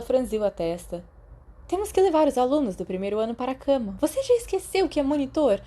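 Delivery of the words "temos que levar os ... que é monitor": put-on higher, fast, scolding young woman; Lily, exasperated. A narrator's reading. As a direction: remind him of his duty, impatient. Lily voiced scolding James. (Lily impaciente repreendendo James)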